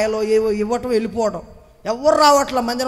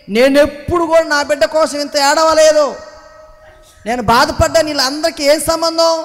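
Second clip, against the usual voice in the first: usual speaking voice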